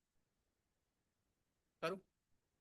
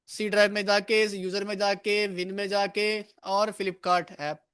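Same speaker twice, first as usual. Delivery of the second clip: slow step-by-step; 9 dB louder, slower than usual. Shown as a demonstration. Teacher walking class through steps.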